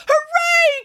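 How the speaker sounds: very high, loud cheer; jubilant cry. A narrator's reading. Nanny learning it is Easter Sunday, high jubilant cheer. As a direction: whoop with delight. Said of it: "cried Nanny Piggins".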